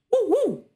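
short, high yappy barks; chihuahua bark. Shown as a demonstration. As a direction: yip like a small dog. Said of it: "Es como el del chihuahua".

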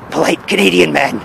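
low, gravelly hero reveal; Polite Canadian Man. Acted. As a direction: repeat the name in a mock Batman growl. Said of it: "keep your voice low and gravelly".

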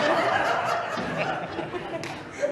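high, loud burst of laughter. Real, not acted. Laughs at low friend count.

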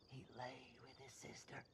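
breathy secret whisper, lips close; creepy whisper. Acted. Seth whispers gossip to the corpses, hushed whisper. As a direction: lean in and whisper a dirty secret.

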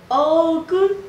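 student pushes Ogun high; loud high try. Shown as a demonstration. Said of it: "You know it's high pitch".

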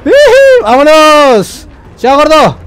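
2 loud high whoop, then a slow stretched shout; 3 celebrate the escape at full voice; 1 triumphant whoop. Real, not acted. 4 gamer taunting the chasing fat enemy, loud triumphant whoop.

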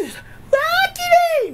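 very high, drawn-out squeal of wonder; hammed-up amazement, stretched out high; mock awe. Pretend mansion owner admires the planetarium, high mock awe.

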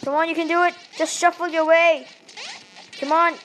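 ordinary talk, nothing marked

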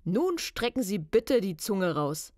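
lightly put-on official caller; slow, deliberate mock inspector instructions; hint of a disguised male inspector; deep man's voice. A narrator's reading. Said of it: "sagte eine tiefe Männerstimme".

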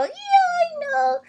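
very high, drawn-out squeal of a reply; comic high voice. Acted. Answering a partner about the spoiled punchline, in a high, slow comic voice. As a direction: stretch it out slowly, way up high.

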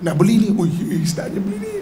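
whiny pleading, jumping up at the end; mock whining. Preacher imitates desire begging to buy, mock whining. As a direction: plead like a nagging child. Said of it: "Dia merengek".

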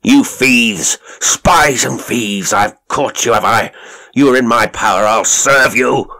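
loud, high, raging accusations; furious shriek. A narrator's reading. Fat father threatening the intruders he has caught, furious shriek. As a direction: rage at intruders, stretching the threats. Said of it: "he shrieked".

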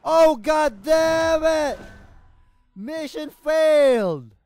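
furious shout. Real, not acted. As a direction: bellow it slowly at the lost mission. Dies in game, yells frustration.

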